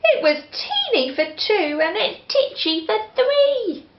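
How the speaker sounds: put-on high, fretful old lady lament; old lady at her wits' end; old lady crying out. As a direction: wail it as a flustered old lady. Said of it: "The little old lady cried".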